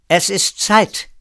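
laut, hoch und langsam wie ein Befehl; lauter Ruf. Acted. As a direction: ruf es als Order. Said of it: "und rief". (Alicia ruft René laut zum Aufbruch)

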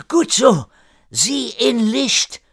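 put-on high, drawn-out French-accented cry; calling out. A narrator's reading. René cheering Erik toward the light, high call. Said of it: "rief René".

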